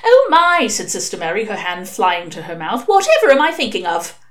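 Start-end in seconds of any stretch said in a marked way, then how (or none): 0.0-0.7 high, louder, aghast nun; flustered exclamation. A narrator's reading. Nun realising she forgot the tea, flustered. 2.9-4.2 higher-pitched flustered nun; lightly acted high exclamation; flustered self-reproach. A narrator's reading. Nun chiding herself over forgotten tea, flustered.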